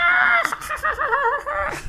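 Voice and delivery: loud high laughing shriek; burst of high laughter. Real, not acted. Cracking up at the friend's story, high shrieking laugh.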